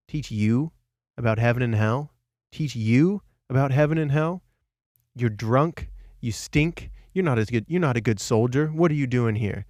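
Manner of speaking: loud, incredulous questions turning to insults; scornful retort. Retold or imitated. Meditating boy provokes the drunk soldier, scornful. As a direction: throw the request back with mock contempt.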